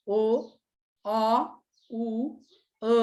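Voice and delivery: "ओ, अ, उ, अ" as separate pure vowels with pauses; sounding out vowels. Shown as a demonstration. Teacher listing the sounds of letter O, sounding out "ओ, अ, उ, अ" one by one. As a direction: give each of "ओ, अ, उ, अ" its own beat. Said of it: "ओचा उच्चार".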